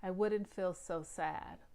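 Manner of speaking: ordinary delivery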